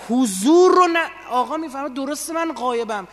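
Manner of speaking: high, loud, insistent sermon delivery; impassioned preaching. Acted. Preacher urging listeners to picture the absent Imam, impassioned.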